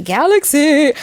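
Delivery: loud, high sudden outburst; excited exclamation. Real, not acted. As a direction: blurt it out excitedly. Sudden outburst mid-reading.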